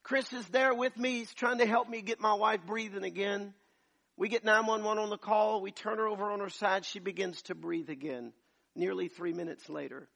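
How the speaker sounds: high, loud preaching narration; preacher reliving the crisis, loud and high; full-force preaching. Acted. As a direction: keep the fire in the telling.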